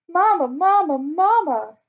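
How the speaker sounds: high, loud wailing parrot voice; a lost bird wailing for its owner; sad wail for help. A narrator's reading. Parrot Al above the trees wailing for Mama, high and loud. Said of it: "It was a sad wail for help".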